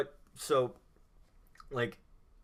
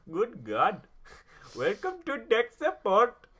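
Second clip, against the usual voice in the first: high, slow mock Indian accent, hammed up; 10 semitones higher, 6 dB louder, slower than usual. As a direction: do a cheesy Indian call-centre voice. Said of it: "I just find the Indian accent funny".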